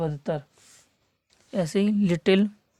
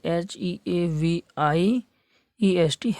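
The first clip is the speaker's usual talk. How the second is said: letters एच ई ए वी आई spelled slowly, one by one; slow spelling; much slower than usual. Shown as a demonstration. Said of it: "ऐसे ही". (धीमी वर्तनी)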